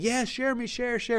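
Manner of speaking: very high-pitched mock-excited chant; eager clamouring. Webinar audience urging coach to share, imitated high eager clamour. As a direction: squeal it like an over-keen crowd. Voicing keen audience replies.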